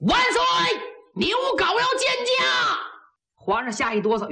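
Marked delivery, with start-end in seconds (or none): 0.1-2.8 high-pitched call from outside the door; shout. A narrator's reading. Niu Gao calls to the emperor from the doorway, shouting. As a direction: shout it out to be let in. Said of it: "牛皋门口喊了一声".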